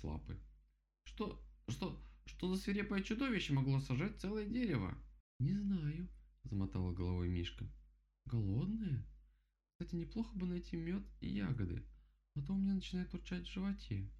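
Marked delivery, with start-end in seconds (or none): 1.0-5.0 высокий, заикающийся от страха голос кролика; пролепечи в страхе, высоко, как сказочный кролик; испуганный кролик. Acted. Испуганный кролик при виде следов. 5.4-6.1 высокий голос медведицы из сказки; ответь высоким игрушечным голосом; сказочная Мишка. Acted. Голос Мишки. 8.3-14.1 высокий простодушный голос медведицы; рассуждай о еде высоко и беззаботно; беспечная сказочная Мишка. Acted. Голос Мишки.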